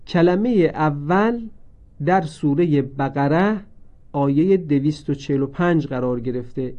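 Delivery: ordinary talk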